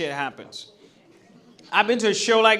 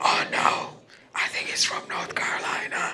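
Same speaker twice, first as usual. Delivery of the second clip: hushed whisper, correcting the last guess. Retold or imitated. Strangers trading guesses about her, whispered. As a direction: whisper it like gossip. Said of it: "I'll hear a whisper".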